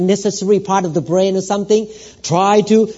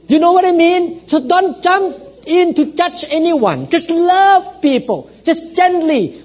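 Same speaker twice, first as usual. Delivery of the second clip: raised, fervent sermon exhortation; preaching; 7 semitones higher than usual. Acted. Pastor exhorting his church to understand each other. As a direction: urge the congregation to love and not judge.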